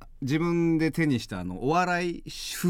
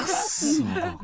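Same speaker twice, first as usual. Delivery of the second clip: stretched-out mock groan; 10 semitones higher, much slower than usual. Drawn-out mock curse.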